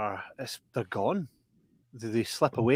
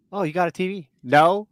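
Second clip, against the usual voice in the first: high put-on voice; 6 semitones higher, 3 dB louder than usual. Acted dialogue.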